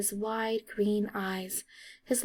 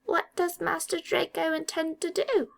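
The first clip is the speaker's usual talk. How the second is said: high elf voice, wary; suspicious elf; 8 semitones higher than usual. A narrator's reading. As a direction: ask warily as the elf.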